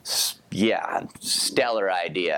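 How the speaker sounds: dry sarcastic "stellar idea"; deadpan sarcasm, slow and low. Acted. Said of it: "That was sarcasm".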